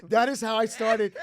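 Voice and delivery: laughing while telling the story. Real, not acted.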